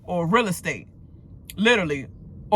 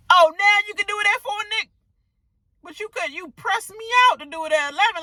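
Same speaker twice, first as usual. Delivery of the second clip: sarcastic mock retort; 13 semitones higher, 4 dB louder, faster than usual. Indignant retort to a coworker.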